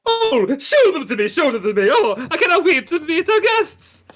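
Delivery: high, loud, excited call; jolly mayor calling out; eager mayor from afar. Acted. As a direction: gush over the guests offstage. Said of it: "You hear in the distance".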